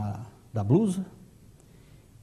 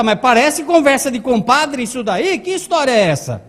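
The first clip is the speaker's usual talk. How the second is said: high, loud annoyed interruption; acted exasperated outburst; irritated; 6 semitones higher, 6 dB louder than usual. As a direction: cut in crossly, voice raised. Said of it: "começou a se irritar".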